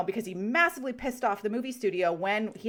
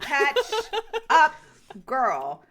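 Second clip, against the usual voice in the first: louder, giggling ribbing; laughing tease; 4 semitones higher, 5 dB louder, much slower than usual. Real, not acted. Friend ribbing a co-host for rarely being online, laughing. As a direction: tease her through a laugh.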